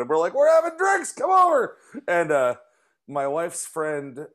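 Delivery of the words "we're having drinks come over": high shouted invite; calling out. Retold or imitated. Inviting friends over, shouting high and loud. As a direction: yell it across the party.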